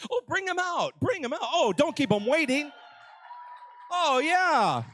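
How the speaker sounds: high, eager calling out; excited. Real, not acted. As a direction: hype up the crowd to bring them out. Excited host calling for guests.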